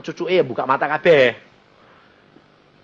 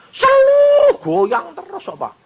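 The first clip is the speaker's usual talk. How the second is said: distressed plea; 5 dB louder, slower than usual. Retold or imitated. Daughter describes shaking mother.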